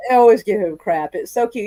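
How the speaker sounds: ordinary talk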